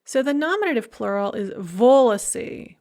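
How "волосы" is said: волосы with the first syllable stressed, VO-lo-sy. Shown as a demonstration. Professor showing students the nominative plural, волосы stressed on first syllable. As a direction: lean on the opening VO of волосы. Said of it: "with the stress on the first syllable".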